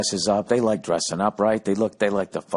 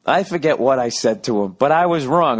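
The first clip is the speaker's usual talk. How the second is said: ordinary talk, nothing marked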